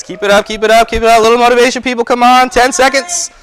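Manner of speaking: high, loud hype yelling; shouted cheering. Real, not acted. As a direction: fire them up, full voice. Coach cheering racers on.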